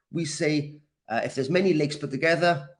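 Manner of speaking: ordinary talk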